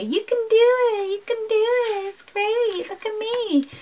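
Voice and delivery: very high, sing-song peppy voice; chirpy, high-pitched fake encouragement; mock magazine cheerleading. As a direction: parody a relentlessly upbeat self-help article in a squeaky high voice. Mocks upbeat magazine article's voice.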